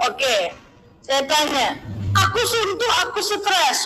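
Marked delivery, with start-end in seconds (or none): 2.2-3.8 higher-pitched mock moan; acted-out plaintive grumble; complaining friend. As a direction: imitate a stressed friend whining. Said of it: "banyak kali nih keluhannya".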